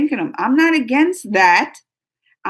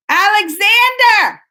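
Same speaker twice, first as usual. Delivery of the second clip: loud, drawn-out shout of a name; calling out; 9 semitones higher, 6 dB louder, slower than usual. Real, not acted. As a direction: call him across the room. Calling to someone.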